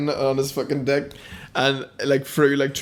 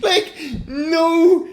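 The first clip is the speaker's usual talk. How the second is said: laughing; 7 semitones higher, slower than usual. Real, not acted. Laughing while telling story.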